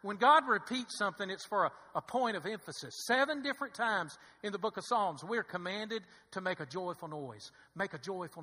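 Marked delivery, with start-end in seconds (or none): none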